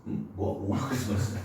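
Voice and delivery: ordinary delivery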